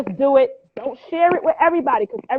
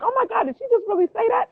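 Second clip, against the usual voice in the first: surprised; 10 semitones higher, faster than usual. Retold or imitated. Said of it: "she was like".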